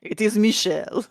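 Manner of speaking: put-on French accent. Sporting a heavy French accent.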